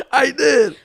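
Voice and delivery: high breathy laughter; laughing. Real, not acted.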